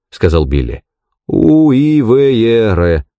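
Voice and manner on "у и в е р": slow, higher, letter by letter; spelling out. Acted. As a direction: spell the name out with drawn-out letters. Spells out his misheard surname. (медленно, по буквам)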